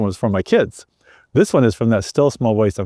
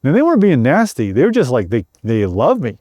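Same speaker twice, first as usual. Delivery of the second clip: ordinary delivery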